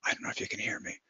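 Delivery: an unvoiced whisper, barely audible. Shown as a demonstration. Doctor demonstrating how the patient talked, in a whisper. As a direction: breathe it out with no voice at all. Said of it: "he talked like this in a whisper".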